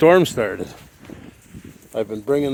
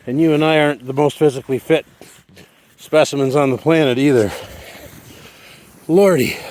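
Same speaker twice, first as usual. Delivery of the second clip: normal talking voice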